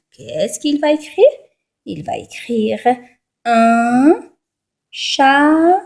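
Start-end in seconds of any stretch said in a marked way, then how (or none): 3.4-5.8 chaton split into two drawn-out syllables, cha, ton. Shown as a demonstration. Teacher dictating what the pupil will write, syllable by syllable. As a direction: sound out "un chaton" piece by piece. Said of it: "Alors, la première syllabe".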